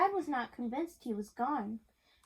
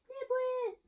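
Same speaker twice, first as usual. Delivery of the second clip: much higher joyful cry of the name; happy call; 12 semitones higher than usual. A narrator's reading. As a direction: call out the cat's name with delight. Boy calls his cat happily.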